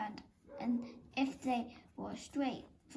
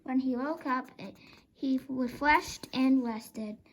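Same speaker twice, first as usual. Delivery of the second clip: plain reading voice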